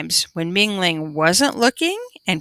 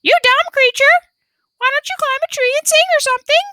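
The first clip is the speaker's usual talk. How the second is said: very high, loud squawking yell; parrot shout; 4 dB louder, much faster than usual. A narrator's reading. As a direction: shout it back fast like a parrot. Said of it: "shouted just as loudly".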